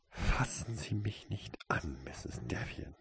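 quiet revulsion, fading almost voiceless; loathing. A narrator's reading. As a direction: push them away with a soft, seething voice. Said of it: "Toriesas Stimme voll Abscheu".

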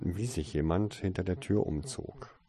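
ordinary delivery, nothing marked

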